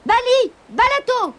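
high-pitched shouted incantation; crying out. A narrator's reading. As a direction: cry out the magic words, high and falling. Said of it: "en criant".

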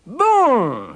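high, drawn-out sneer; one long, loud, derisive word; sneering wolf. Acted. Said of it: "ricana-t-il".

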